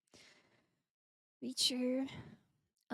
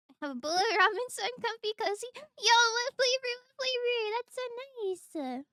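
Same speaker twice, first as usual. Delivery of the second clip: excited; 10 semitones higher, 9 dB louder than usual. Real, not acted. Excited reading chat.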